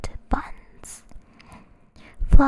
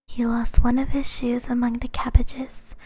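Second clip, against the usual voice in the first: plain reading voice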